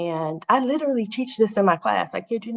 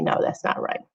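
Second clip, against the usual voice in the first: whispered quote; 4 dB quieter than usual. Retold or imitated. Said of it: "he keeps telling me".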